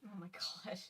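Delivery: breathless shocked whisper. Real, not acted. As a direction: gasp it out under your breath. Listener reacts to sad confession.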